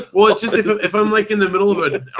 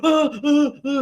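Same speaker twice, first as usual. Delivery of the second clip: high, panicky yelping breaths; startled gasps; slower than usual. Retold or imitated. Dad showing how he jolts awake, startled gasps. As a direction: re-enact gasping awake in fright. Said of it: "I did my whole thing".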